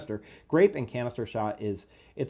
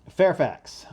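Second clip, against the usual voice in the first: Fairfax in a put-on Southern accent, higher and louder; 4 semitones higher, 5 dB louder than usual. Reading a Confederate soldier's letter in a Southern accent. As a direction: open the letter in a Southern drawl. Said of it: "I'll try and put on a Southern accent".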